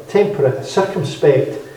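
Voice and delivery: usual speaking voice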